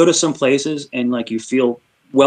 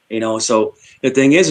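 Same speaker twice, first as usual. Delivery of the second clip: ordinary talk, nothing marked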